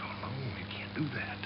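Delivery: weak, voiceless whisper of a dying snake. Acted. Crushed snake refusing the rabbit's help, whispering. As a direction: hiss it out barely there.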